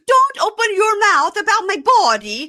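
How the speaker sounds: very high mock shriek; shrill retold outburst; hysterical yelling. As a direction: act out an outraged wife screaming at her husband. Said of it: "as she's yelling".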